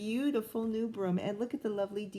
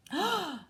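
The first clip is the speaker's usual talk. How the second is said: dramatic gasp; 9 semitones higher than usual. Acted. Reader gasps at the beast.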